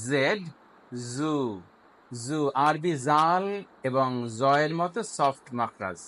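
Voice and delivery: ordinary talk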